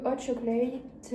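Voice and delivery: drawn-out, lightly hammed-up English accent; slow English accent, playing at it; put-on English accent. Vlogger reading the chocolate's English name, put-on English accent. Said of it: "je commence à faire mon accent".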